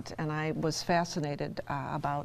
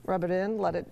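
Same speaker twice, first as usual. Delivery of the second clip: ordinary talk, nothing marked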